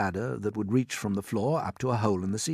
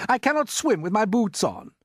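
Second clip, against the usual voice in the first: shouted; 13 semitones higher, 6 dB louder than usual. A narrator's reading. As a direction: bellow it in a panic. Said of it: "shouted the centipede".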